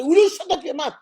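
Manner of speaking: bitter mother shouting at her son; mother yelling at child. Retold or imitated. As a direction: bark at the kid like a fed-up mother. Angry mother snaps at child.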